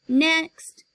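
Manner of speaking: loud high call of next; secretary calling into a waiting room. A narrator's reading. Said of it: "she called to the waiting kids".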